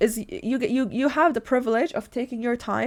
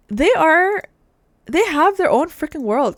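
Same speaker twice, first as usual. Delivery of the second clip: excited; 6 semitones higher, 6 dB louder, slower than usual. Real, not acted. Enthusing about bugs.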